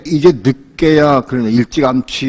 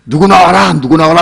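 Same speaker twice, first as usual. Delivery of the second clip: loud, higher mock shout; imitated shout; 5 semitones higher, 6 dB louder than usual. Pastor retelling the drunk calling people out, higher loud mock shout. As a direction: yell out a name like an angry drunk. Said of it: "소리치는데".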